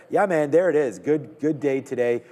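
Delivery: happy cry as the trade fills; excited. Real, not acted. Order fills live on stream.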